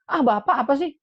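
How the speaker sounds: high, loud, exasperated retort; snappy back-talk. Acted. Child brushing off a parent with "ah", high exasperated back-talk. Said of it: "Mungkin ada sebagian orang tua dibilang".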